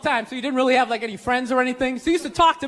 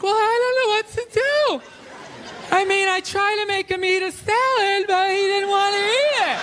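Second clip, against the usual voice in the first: higher, whining helpless parent; 5 semitones higher than usual. Retold or imitated. Mocking a parent to the crowd, higher and whiny. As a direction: play the parent who gives up. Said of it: "They're like".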